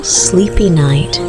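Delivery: ordinary delivery, nothing marked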